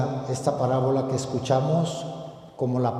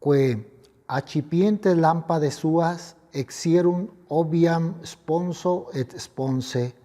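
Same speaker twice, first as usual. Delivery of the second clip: raised, solemn gospel proclamation; liturgical proclamation; 6 semitones higher, 5 dB louder than usual. Acted. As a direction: proclaim it like a priest at the altar. Latin gospel proclaimed at Mass.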